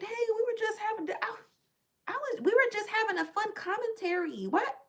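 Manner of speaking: very high-pitched feigned innocence; hammed-up high, defensive protest; mock innocent defence. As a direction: plead innocence in a squeaky high voice. Voicing her own defence to accusers.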